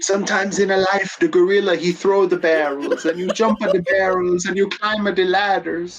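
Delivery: bad Italian accent. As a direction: preach it like a cartoon plumber. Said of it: "bad Italian accents".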